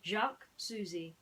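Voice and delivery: ordinary delivery, nothing marked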